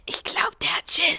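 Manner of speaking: tonlos geflüstert; flüstern. Acted. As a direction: den anderen leise zuflüstern. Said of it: "flüsterte Pupsi zu den anderen". (Pupsi flüstert den Freundinnen zu)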